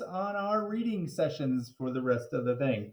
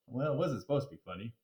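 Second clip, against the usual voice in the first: usual speaking voice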